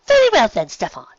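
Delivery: lightly voiced elegant pony; refined mare. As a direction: answer sweetly and properly. Rarity answers the duke.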